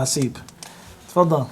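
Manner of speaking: ordinary delivery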